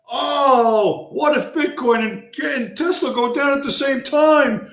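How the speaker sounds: high, whining mock panic; panicked stockbroker. Mocking a Wall Street broker's panicked whine. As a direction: mimic a frightened broker losing it. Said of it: "The panic in his voice".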